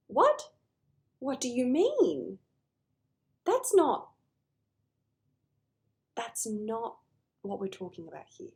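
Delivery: high, loud stunned What?; incredulous, pitch shooting up then settling; shocked disbelief. Retold or imitated. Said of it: "And my jaw dropped".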